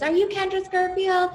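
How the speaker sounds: mock the host by name from the crowd; heckler. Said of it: "a heckler came and goes".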